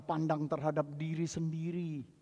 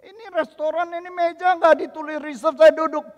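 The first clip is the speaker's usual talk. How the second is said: loud, high, affronted insistence; indignant protest; 14 semitones higher, 17 dB louder than usual. Retold or imitated. Diner insisting to himself the table was his, indignant protest. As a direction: protest that you were there first.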